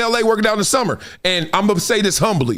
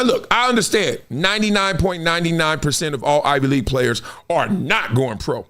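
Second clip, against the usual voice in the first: ordinary talk, nothing marked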